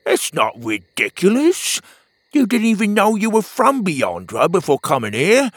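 pep up a gloomy buddy; upbeat friend. Acted. Petey cheering up Pockets, bright friend voice.

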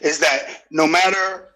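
ordinary delivery

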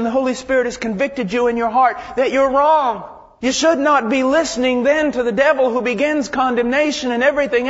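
loud, urgent exhortation from the pulpit; forceful preaching. Real, not acted.

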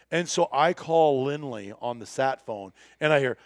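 normal talking voice

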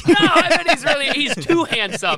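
giggly, swooning; laughing. Real, not acted. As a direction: laugh through it, gushing. Swooning over the waiter.

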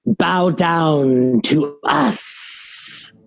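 slow, drawn-out command; commanding spell. Acted. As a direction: intone the spell word by word, very slowly. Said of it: "cast command and say".

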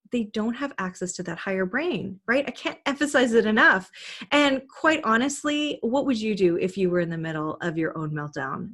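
ordinary talk, nothing marked

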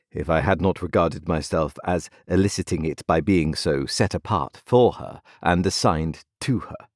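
plain reading voice

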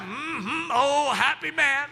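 Southern hum, then high drawn-out exclamation; slow, delighted Southern amen; Southern mm-hmm. Shown as a demonstration. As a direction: agree with relish, Southern style. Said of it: "the Southern, mm-hmm".

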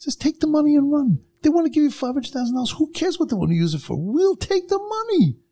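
high-pitched mock yell at the community; retold yelling. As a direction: bark it at them like a pushy politician. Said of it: "He starts yelling at us".

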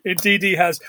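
normal talking voice

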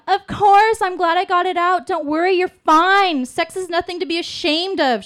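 high, loud shouted reassurance; screaming after someone. Acted. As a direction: yell it cheerily across the hallway at a departing neighbor. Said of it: "she screams after me".

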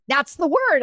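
high, emphatic retold insistence; insistent. Mom insisting on her word through the medium, insistent.

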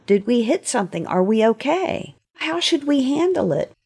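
ordinary delivery

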